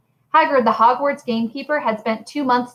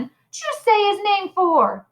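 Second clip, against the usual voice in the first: much higher, slow and aghast; shocked disbelief; 10 semitones higher, slower than usual. A narrator's reading. Stan to Harry, still aghast, high and slow. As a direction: drag it out, still reeling.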